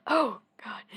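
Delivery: loud imitation of a shocked girl; mock horrified. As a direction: blurt it in mock dismay. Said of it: "like, traumatized seeing the witch die".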